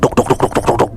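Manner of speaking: rapid spoken knocking noises; imitated door pounding. Someone pounds the friends' door, imitated knocking. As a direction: rattle off knocks like a fist on wood. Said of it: "Digedor kenceng banget lah".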